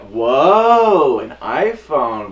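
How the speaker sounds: loud, drawn-out whoa from mom; a mother startled by a pricey gift; mom's amazed whoa; surprised mom. Retold or imitated.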